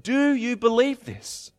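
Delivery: loud, high proclamation; rising to a ringing question; proclaiming Jesus. Acted. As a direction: ring it out as Jesus' promise.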